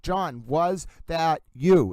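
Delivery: "John, was that you" slowly stretched; drawn-out words. Shown as a demonstration. Host quoting her accented words, drawn out slowly. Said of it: "you really draw them out".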